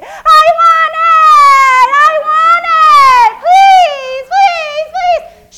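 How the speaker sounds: very high, loud, drawn-out screaming wail; child's tantrum. Retold or imitated. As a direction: scream it like a toddler's meltdown. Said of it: "you've seen the kids who say".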